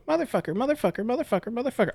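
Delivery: put-on high sing-song kid voice; imitating little sister. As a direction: chant it like a child in the backseat. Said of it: "little sister's in the backseat going".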